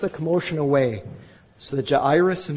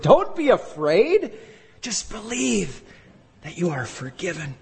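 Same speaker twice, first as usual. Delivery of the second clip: emphatic reassurance; 4 semitones higher than usual. Acted. High slow emphatic repeat.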